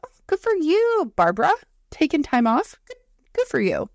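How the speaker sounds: high sing-song fake cheer; mock sarcastic praise. To coworker Barbara about her time off, fake sweet sarcasm. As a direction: gush over it, not meaning a word.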